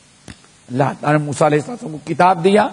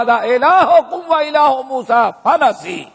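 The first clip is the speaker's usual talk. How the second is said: Quranic recitation; 5 semitones higher, slower than usual. Acted. Quran verse recited again.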